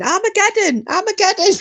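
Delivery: high, yelled panic cry; retold shouting. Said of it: "with the hamster up his bum shouting".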